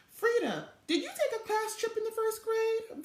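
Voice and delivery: put-on high, eager little girl; Velma's question. A narrator's reading. Little sister asking big sister about trips, high eager voice. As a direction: lift into a small kid's hopeful ask.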